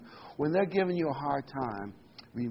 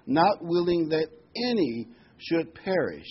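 slow, louder, higher, with "any" punched; emphatic stress on any; 5 semitones higher, 6 dB louder than usual. Real, not acted. Preacher correcting a wrong idea of God, emphatic on "any". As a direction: hammer "any", spacing out the words. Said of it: "I want to emphasize that word".

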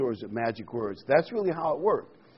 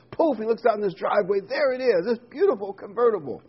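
higher, faster, wide-eyed telling; excited storytelling; 9 semitones higher, 4 dB louder than usual. Acted. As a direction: build it up with delight. Joke narration building excitement.

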